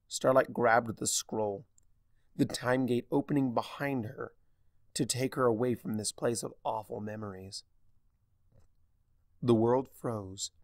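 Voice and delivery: plain reading voice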